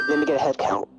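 normal talking voice

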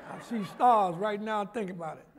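laughing. Real, not acted. Recalling the slap, laughing.

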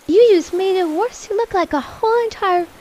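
loud, appalled mom outburst; acted scolding. Mother sees child's eyeshadow, scolding in appalled tones. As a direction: berate loudly, as a mock-horrified mother.

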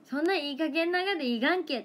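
high, loud mock scolding in Toyama dialect. Shown as a demonstration. Showing viewers real local speech, mock scolding in Toyama dialect. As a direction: tell someone off in broad Toyama dialect. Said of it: "そんな感じでは使うけど".

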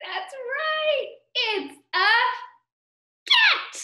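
very high, delighted announcement; squealed storytime answer; excited reveal. Pretend. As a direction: build to a loud, high final word.

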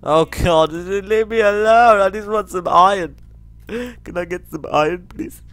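high, loud desperate begging; panicked pleading. Real, not acted. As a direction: beg in a frightened high voice.

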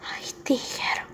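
slow whisper of the name; a soft graveside whisper; whispered grief. Acted. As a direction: sigh the name out, no voice. Mourning at the grave.

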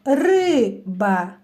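риба with a long, stretched first syllable; stress on the first syllable. Shown as a demonstration. Teacher calling out a word to children, first syllable stretched. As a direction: call out риба, drawing out the stressed ри. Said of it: "необхідно слово погукати".

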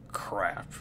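hushed, voiceless aside; whispered curse. Acted. Character reacting to news from space, whispered curse. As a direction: drop low and whisper it.

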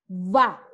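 "But" with a short open uh vowel like in "bus"; English but. Shown as a demonstration. Teacher modelling the word for students, clear English but. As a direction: say "But" with the vowel near an A, not U. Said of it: "Similar a la pronunciación del bus".

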